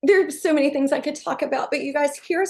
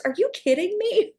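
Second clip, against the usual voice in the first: excited disbelief; 7 semitones higher than usual. Real, not acted. Excited about earnings total.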